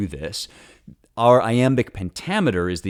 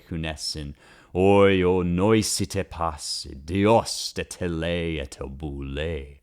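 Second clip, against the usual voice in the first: slow, measured Greek hexameter; Homeric Greek recitation; much slower than usual. Shown as a demonstration. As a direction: chant the epic line with a rolling beat. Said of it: "hear the music of it".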